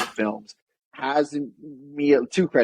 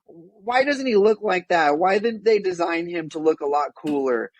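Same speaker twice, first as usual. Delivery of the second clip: whiny nerd voice; 5 semitones higher than usual. Retold or imitated. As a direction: whine it like a fussy fan. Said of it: "with their whiny voice".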